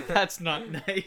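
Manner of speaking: slow, drawn-out mock-Australian accent. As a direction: tease in a stretched Australian accent, half laughing. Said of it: "It's talking in Australian accent".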